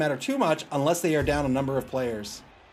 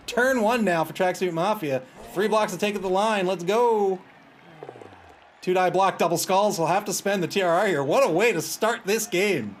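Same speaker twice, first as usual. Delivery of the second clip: higher, driving live call; energized match commentary; play-by-play; 4 semitones higher, 4 dB louder than usual. Real, not acted. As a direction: call the opening action like a sportscaster.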